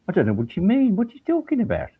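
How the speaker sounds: high, baffled Brummie; Brummie accent. Acted. Prosecutor puzzled by his colleague's praise, Brummie accent. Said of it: "It was his actual accent".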